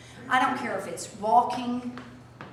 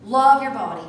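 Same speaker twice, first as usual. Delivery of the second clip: Love your body, louder, stress on love; emphatic exhortation; 11 dB louder than usual. Shown as a demonstration. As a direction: repeat it firmly. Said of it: "repeated exhortation".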